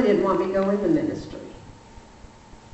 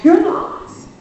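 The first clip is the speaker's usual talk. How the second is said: "Your loss" louder and higher, punched hard; emphatic repeat; 8 semitones higher, 8 dB louder than usual. Shown as a demonstration. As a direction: hit "Your loss" as a parting shot. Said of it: "It's gonna be your loss".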